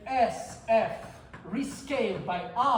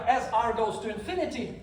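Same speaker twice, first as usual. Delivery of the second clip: normal talking voice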